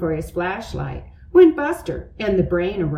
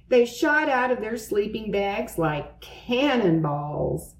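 ordinary delivery, nothing marked

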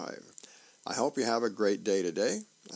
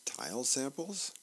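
quiet questioning thought, rising at the end; dubious question; 3 semitones higher, 5 dB quieter than usual. Acted. Husband on the couch thinks about his wife's request, dubious. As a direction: wonder it softly to yourself.

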